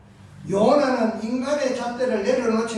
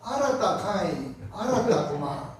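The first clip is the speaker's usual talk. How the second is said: Busan dialect. Acted. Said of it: "내 사투리가 좀 정확해요".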